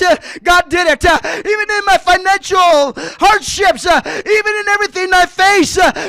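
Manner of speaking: full-force preaching. Acted. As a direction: preach it out, building to a shout. Preacher chants refrain at full force.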